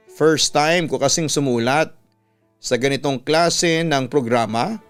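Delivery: ordinary talk, nothing marked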